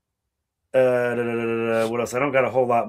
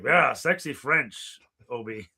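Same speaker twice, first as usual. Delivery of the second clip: put-on sexy French accent; slower than usual. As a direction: lay on a sultry French accent. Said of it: "He's like".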